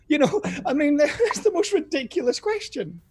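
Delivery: high, incredulous protest through laughter; laughing protest. Real, not acted. Contestant mocking the quizmaster's question, laughing in protest. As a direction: laugh while objecting, pitch riding high.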